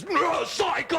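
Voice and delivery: high garbled shout. Pretend. What the woman hears, a high garbled shout. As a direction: shout it so it sounds crazy. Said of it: "all she hears is".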